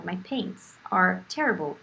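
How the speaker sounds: normal talking voice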